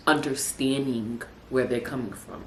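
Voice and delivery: ordinary talk, nothing marked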